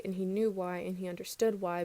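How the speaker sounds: plain reading voice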